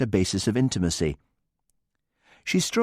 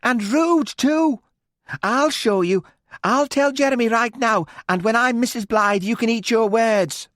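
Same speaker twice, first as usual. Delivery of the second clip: high, loud furious threat; angry; 14 semitones higher, 4 dB louder than usual. A narrator's reading. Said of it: "Alice leapt to her feet".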